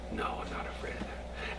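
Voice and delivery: faint, unvoiced whisper of a dying man; soft whisper. Zusia on his deathbed answering disciples, soft whisper. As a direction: whisper it calmly.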